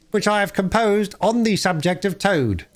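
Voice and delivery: high, smug weasel voice; smug announcer. Acted. Chief Weasel announcing his song to the hall, high and smug. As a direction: announce it like a show-off.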